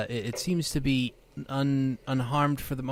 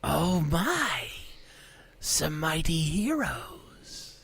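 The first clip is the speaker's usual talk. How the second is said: unearthly voice; 6 semitones higher, much slower than usual. Acted. Unseen creature answers the heroes.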